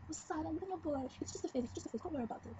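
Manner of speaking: a hint of a dismissive mother; lightly mocking mom. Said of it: "she got so mad".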